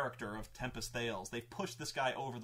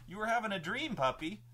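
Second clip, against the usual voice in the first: talking to the dog; 7 semitones higher than usual. Real, not acted. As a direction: coo at the sleepy dog. Talks to dog.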